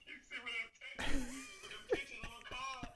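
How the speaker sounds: laughing through the words; laughing while recalling Pops' birthday texts. Real, not acted.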